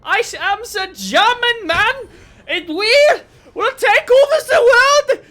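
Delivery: high, shouted German caricature; bad German accent. Co-caster requests German before the match intro, shouted mock German accent. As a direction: yell like a hammed-up German announcer. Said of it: "Do German. I actually can barely do a German".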